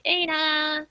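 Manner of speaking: a high mock call from downstairs; mock yell. Acting out someone calling Aina from downstairs, mild mock yell. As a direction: call the name up the stairs, half-hearted. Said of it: "Someone's screaming from downstairs".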